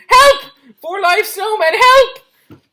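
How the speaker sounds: screaming high for help in terror; alarmed shout. A narrator's reading. Boy fleeing the snowmen, screaming in alarm. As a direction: yell it out as loud as you can, panicked. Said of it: "the boy shouted in, in alarm".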